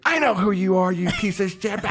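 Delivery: high-pitched mock tirade; hammed-up angry insult; retold yelling. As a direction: imitate an irate woman laying into a caller. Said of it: "Just starts yelling".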